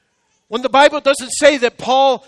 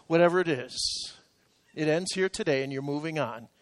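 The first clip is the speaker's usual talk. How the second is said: low, soft, grave assurance; solemn pronouncement; 7 semitones lower, 9 dB quieter than usual. Retold or imitated. Visiting preacher laying hands on him, retold low solemn assurance. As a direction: retell it quietly and firmly, dropping low. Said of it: "looked right at me in the eye and he said".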